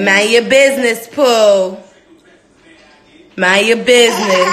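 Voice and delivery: high, loud teasing prompt; sing-song prompt. Real, not acted. Mother teaching baby a sassy phrase, sing-song.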